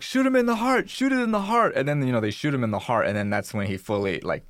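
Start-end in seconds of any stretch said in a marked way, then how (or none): 0.0-1.7 urgent yell. Retold or imitated. Guy yells to shoot.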